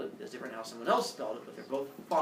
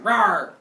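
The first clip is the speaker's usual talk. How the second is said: loud playful rawr; mock roar; 4 semitones higher, 11 dB louder than usual. Hades appearing to grab the speaker, mock roar. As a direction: roar it like a cartoon monster. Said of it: "Hades would grip like".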